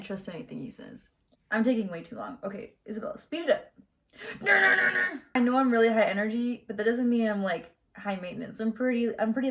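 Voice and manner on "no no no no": higher, louder rapid no's; emphatic refusal. Real, not acted. She stops her own tangent. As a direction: shoot down the idea fast. Cutting herself off.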